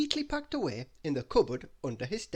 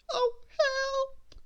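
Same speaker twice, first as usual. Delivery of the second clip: much higher, squeaky scared voice; Mister Jelly spotting a bear in camp, high and frightened; frightened cry; 4 dB louder than usual. Acted. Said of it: "he whispered very quietly".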